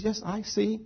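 very soft, patient invitation; gentle listener. Retold or imitated. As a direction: invite them to confide, hushed and tender. Jesus gently invites them to talk.

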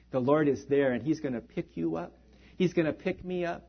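usual speaking voice

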